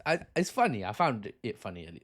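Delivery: ordinary talk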